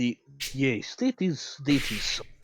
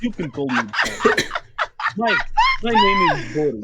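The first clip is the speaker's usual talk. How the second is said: very high, loud mock Russian; Russian accent; 4 dB louder than usual. [name] as Boris announcing his name in a hammy voice.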